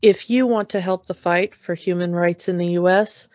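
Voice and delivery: low, steady PSA read; announcement read. Acted. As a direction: read it like a public notice. Reads closing PSA announcement.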